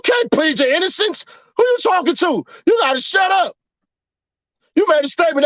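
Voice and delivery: 입 다물라고 소리치며; shouting. Acted. Shouting at imagined snitch.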